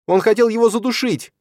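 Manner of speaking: loud, very high-pitched cry; wailing. A narrator's reading. Stepmother accusing Числав to his father, wailing. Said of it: "зарыдала Мацоха".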